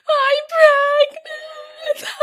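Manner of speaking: very high, squeaky imitation wail; imitating tearful, high-pitched blubbering; mock hysterical crying. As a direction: squeal it out like a hammed-up sobbing fit. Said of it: "crying hysterically".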